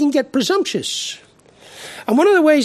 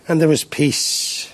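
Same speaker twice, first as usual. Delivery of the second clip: ordinary talk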